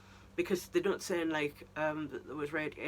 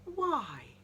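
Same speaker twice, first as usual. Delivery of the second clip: half-joking outrage at the casting; exasperated why; 7 semitones higher than usual. Retold or imitated. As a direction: demand an answer in mock despair. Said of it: "So I'm just like".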